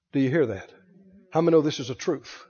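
ordinary talk

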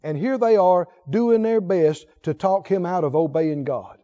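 normal talking voice

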